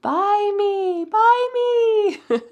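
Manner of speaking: high, drawn-out singsong plea; tempting high-pitched come-and-get-me call; calling out temptingly. Pretend. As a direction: speak as an item begging to be bought. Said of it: "It's calling your name".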